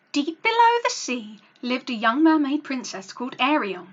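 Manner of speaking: put-on lively read-aloud for children; storybook narration. A narrator's reading. Narrator reads the mermaid tale to children, lively storytelling. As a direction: tell the tale to young listeners.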